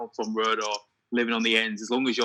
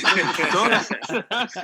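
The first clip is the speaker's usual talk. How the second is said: very slow, much higher "oh my God" breaking into a laugh; laughing mock gush; 8 semitones higher, 5 dB louder, much slower than usual. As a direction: stretch it out amazed and laughing. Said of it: "And I'm like".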